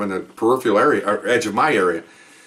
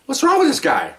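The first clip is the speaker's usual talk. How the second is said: much higher, baffled outburst; incredulous; 11 semitones higher than usual. Retold or imitated. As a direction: toss it up in disbelief. Exasperated cop in retold chase.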